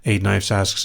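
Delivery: ordinary delivery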